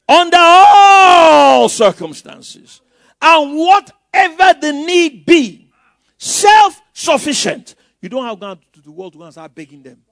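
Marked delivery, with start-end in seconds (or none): 0.1-7.7 loud, high, slow pulpit shout; shouted preaching. Acted. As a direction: call it out with full force to the pastor. Preacher calling out scripture.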